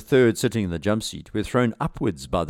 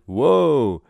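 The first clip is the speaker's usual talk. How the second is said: loud, high whoa; raised, acted shout; 6 semitones higher, 6 dB louder than usual. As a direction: shout it in alarm as the pilot. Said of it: "and shouted".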